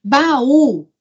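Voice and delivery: "baú" with a strong, lifted Ú; "baú" as two clear beats, BA-Ú; stress on Ú. Shown as a demonstration. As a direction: say "baú" deliberately, leaning on the Ú. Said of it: "Se eu falo assim".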